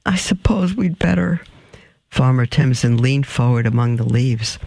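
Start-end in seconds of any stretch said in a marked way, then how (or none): 0.1-1.4 higher, words on a held breath. A narrator's reading. Jill agreeing with Audrey to go on, breath held. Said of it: "doing the same kind of breath".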